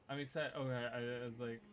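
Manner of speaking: ordinary delivery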